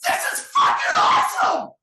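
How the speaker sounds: full-throttle raw scream, drawn out; explosive, very loud roar of hype; legit fired up. Acted. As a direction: scream it like a truly juiced crowd. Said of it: "people are legit fired up".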